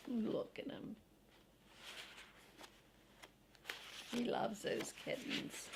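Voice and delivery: hushed unvoiced aside; whisper. Real, not acted. Parent whispering to a child about the dog. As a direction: whisper it so the listener leans in.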